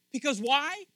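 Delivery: high, loud rising sermon build; loud preaching climb; preaching at full force. Real, not acted. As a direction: lift the room with every line.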